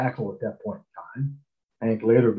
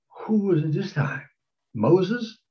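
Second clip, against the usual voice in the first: mock disbelief; 8 semitones higher, slower than usual. Mock disbelief at another call.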